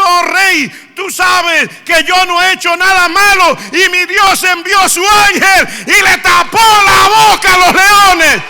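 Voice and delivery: loud, high, slow triumphant call from below; jubilant shout. Retold or imitated. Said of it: "salió un grito". (Daniel grita desde el foso al rey, triunfante)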